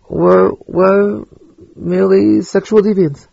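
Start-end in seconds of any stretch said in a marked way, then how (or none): 1.8-3.3 "merely" set out high and deliberate; pointed, raised emphasis on "merely"; stress on merely. Real, not acted. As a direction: lean on the word "merely". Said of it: "I stress the word merely".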